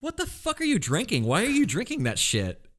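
high-pitched disbelief; stunned, pitch shooting up; incredulous. Real, not acted. Mock outrage at friend's drink.

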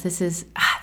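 usual speaking voice

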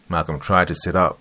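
ordinary delivery, nothing marked

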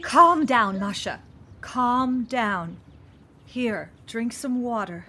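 loud, firm calm-down; urgent calming. Acted. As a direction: command her to settle, firmly. Calming distraught Masha.

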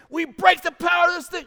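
high, loud, commanding declaration; bold shouted prayer. Retold or imitated. Team growing bold in prayer, shouted declaration. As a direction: cry out boldly against the evil. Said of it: "boldness is happening".

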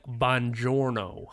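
put-on slow Italian in an American accent; drawn-out, badly American-accented Italian. Brooklyn guy trying to speak Italian, in a thick American accent. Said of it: "guy from Brooklyn who was trying to speak".

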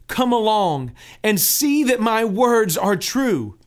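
high, loud pleading pilgrim. Acted.

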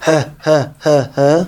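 mimicked eager "heh, heh"; imitated pupils' grunts. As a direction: imitate kids grunting for the teacher's attention. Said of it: "הייבט מען צו מאכן".

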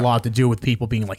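ordinary talk, nothing marked

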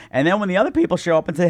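ordinary delivery

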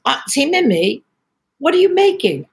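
put-on a light Scouse-accented kid's question; Liverpudlian accent. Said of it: "with a thick Liverpudlian accent".

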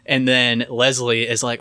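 ordinary talk, nothing marked

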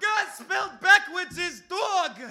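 high-pitched imitated yell of a friend; retold scream. Friend jumping up in the movie theater, screaming high. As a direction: squeal it out like a kid in the theater. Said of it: "starts screaming".

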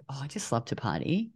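breathy whispered mock confession. Mimicking neighbours who love to party, teasing whisper. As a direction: whisper it as a cheeky tease.

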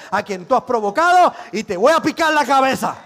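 loud acted battle challenge; defiant shout. David challenges the giant in God's name, shouting. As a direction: build into a shouted threat.

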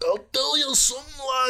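very high mock Scottish threat; Scottish accent. Instead of fleeing, she threatens in a high brogue. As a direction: menace them like a wild old Scot. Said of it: "instead, I'm like".

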